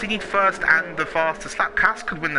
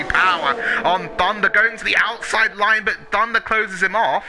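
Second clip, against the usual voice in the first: excited; 5 semitones higher than usual. Real, not acted. Live wheel-to-wheel battle.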